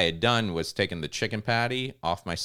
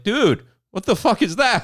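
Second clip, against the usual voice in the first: high, loud amazed yell; passerby yelling at the sandwich; amazed dude; 12 semitones higher, 6 dB louder than usual. Retold or imitated. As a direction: gawk at the triple stack. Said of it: "They're like".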